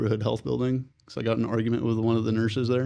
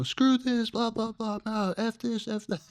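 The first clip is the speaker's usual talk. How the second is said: high, trailing rant that fades softer; mock angry rant; 11 semitones higher, 4 dB quieter, slower than usual. Man ranting to his counselor about being thrown out, high venting that trails off. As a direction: vent it high, then let it fade.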